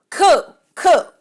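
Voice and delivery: high, loud mocking cool cool; mock his lazy reply, exasperated; annoyed imitation of a lazy texter; mock dismissive reply. Fool's reply voiced again.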